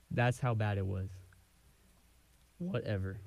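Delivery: usual speaking voice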